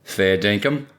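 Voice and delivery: lightly put-on Australian accent; casual Australian accent. A narrator's reading. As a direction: toss it off in mock Australian. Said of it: "now using an Australian accent".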